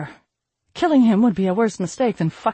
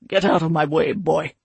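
growling; 4 dB louder than usual. A narrator's reading. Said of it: "My father growled".

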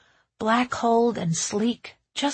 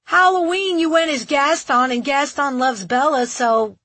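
high, loud rebuttal easing off toward the end; raised-voice comeback; shouted argument; 6 semitones higher, 6 dB louder than usual. A narrator's reading. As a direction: argue it back at a kid through a door. Shouting match with child continues.

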